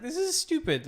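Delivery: fed-up buyer venting; frustrated. Retold or imitated. Acted frustrated customer quote.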